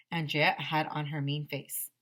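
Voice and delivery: ordinary delivery, nothing marked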